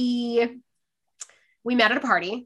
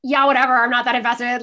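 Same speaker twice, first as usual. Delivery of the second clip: loud, higher brush-off at first; mock dismissive; 4 semitones higher, 7 dB louder than usual. Friend brushing off an excited fan at a party, dismissive. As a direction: imitate a friend who couldn't care less.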